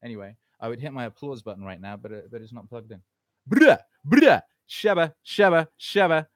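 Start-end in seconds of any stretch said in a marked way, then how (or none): 3.5-6.3 loud, high hype shouts; shouted dancehall-style ad-libs. Pretend. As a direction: bark it like a dancehall hype man.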